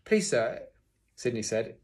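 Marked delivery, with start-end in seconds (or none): 0.0-0.7 raised, louder schoolboy plea; pleading pupil. A narrator's reading. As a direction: speak up to the master. Pupil nervously owns up to teacher.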